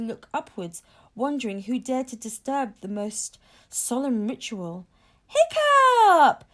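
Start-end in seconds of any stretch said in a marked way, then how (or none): 5.3-6.4 very high, loud calling-out; long, ringing shout that falls at the end; joyful shout. A narrator's reading. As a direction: holler the name in delighted recognition. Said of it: "shouted Thuggery the Meathead, joyfully recognizing".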